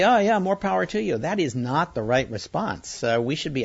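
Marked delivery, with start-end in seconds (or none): 0.0-1.2 higher-pitched, louder mock go-along voice; mock acquiescence. Critic voicing officials who excuse espionage, mock acquiescence. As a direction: imitate someone feebly giving in, pitched up. Mocking administration's imagined passive response.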